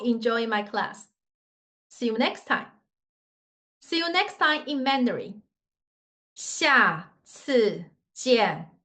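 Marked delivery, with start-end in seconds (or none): 6.3-8.7 "下次见" slow, each syllable falling; fourth tones. Shown as a demonstration. Teacher teaching see you next time, "下次见" falling slowly. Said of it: "Fourth tone".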